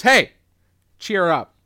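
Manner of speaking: sharp loud hey, then easing off; jovial imitated pep talk; Tom Atkins impression. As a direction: call out, then nudge them to lighten up. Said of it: "inflection is so spot on for him".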